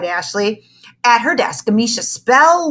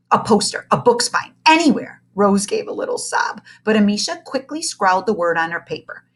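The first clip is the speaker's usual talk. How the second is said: ordinary delivery, nothing marked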